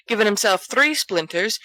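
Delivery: plain reading voice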